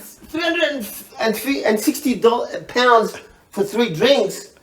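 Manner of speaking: retold outraged disbelief at the bill; stunned disbelief. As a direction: drag each word out in disbelief. Retelling shock at the bill.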